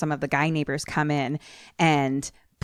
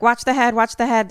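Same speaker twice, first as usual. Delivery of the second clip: louder, higher mock warning shouts; rescuers calling out; 7 semitones higher, 8 dB louder than usual. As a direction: imitate men calling instructions over noise. Voices rescue men calling out.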